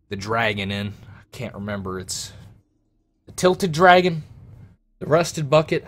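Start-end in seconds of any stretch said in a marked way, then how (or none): 3.3-5.8 higher, louder unsure guesses; guessing captain. Acted. Captain fumbling for the tavern name, higher and louder. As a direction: toss out names you can't recall.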